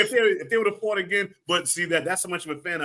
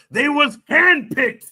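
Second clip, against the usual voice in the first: mock Mickey rasp; 11 semitones higher, 8 dB louder, much slower than usual. Quoting Mickey in Rocky III.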